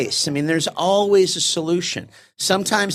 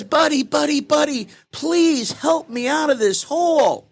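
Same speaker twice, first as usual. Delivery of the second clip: pleading to friend; 10 semitones higher than usual. Retold or imitated. Man in hole begs his friend, high pleading. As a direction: call up to your friend, pitched high.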